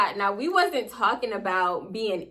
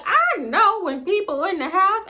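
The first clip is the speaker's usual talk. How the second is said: high, louder grandma voice; imitated grandma, pitched way up; retold grandma; 11 semitones higher, 4 dB louder than usual. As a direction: insist like a grandmother who won't be fooled. Retold grandma insisting she knows.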